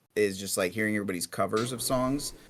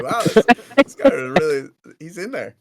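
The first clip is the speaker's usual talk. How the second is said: higher, much louder retold wow; amazed reaction; 5 semitones higher, 11 dB louder than usual. Host recalling a first impression to a guest, amazed. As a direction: blurt it out in amazed disbelief. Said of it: "I was like".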